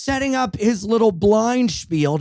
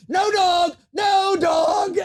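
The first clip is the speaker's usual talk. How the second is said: mock shout; much slower than usual. Subway singer yelling her changed lyric at him, high and shouted. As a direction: bellow it like a street singer gone off-script. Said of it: "she went".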